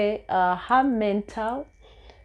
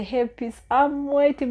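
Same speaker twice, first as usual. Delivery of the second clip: put-on light Kenyan accent, rising higher; imitating her Kenyan mentor's accent, a bit higher; 7 semitones higher, 3 dB louder than usual. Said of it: "with her Kenyan accent".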